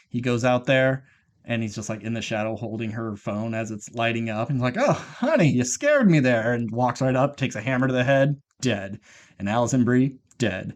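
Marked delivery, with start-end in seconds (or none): 4.8-6.5 high-pitched "oh, honey", slowing after; mock relieved startle. Husband finding his wife in the shadow, startled. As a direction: imitate a startled spouse, pitched up.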